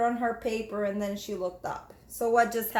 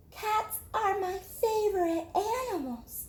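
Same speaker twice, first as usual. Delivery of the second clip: put-on young girl voice; 8 semitones higher, 3 dB quieter than usual. Jamaica telling her teacher, in a little girl voice. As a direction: speak up brightly as a small child. Girl's voice in story.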